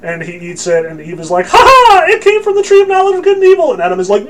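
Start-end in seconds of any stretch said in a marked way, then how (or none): 1.5-3.7 very high, loud, fast falsetto; hammed-up villainous squeal of triumph; mock gloating Eve. Eve revealing her trick to Adam, gloating falsetto.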